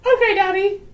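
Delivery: high, loud put-on child voice; squeaky mock-obedient reply; obedient little girl. Daughter answering Daddy, high and squeaky. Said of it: "And she's like".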